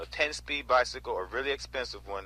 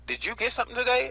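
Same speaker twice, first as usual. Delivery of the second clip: higher, brighter-pitched asking voice of the couple; lightly voiced couple asking about the win; voicing the couple; 11 semitones higher, 3 dB louder than usual. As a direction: lift the voice to play the curious couple.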